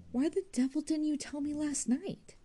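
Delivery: flung out in frustration; exasperated. Acted. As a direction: Jude reproaching Arabella, exasperated. Jude angry at the secret.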